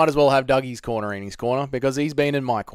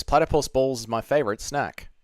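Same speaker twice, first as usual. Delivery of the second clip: normal talking voice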